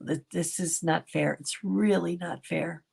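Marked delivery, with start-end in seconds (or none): none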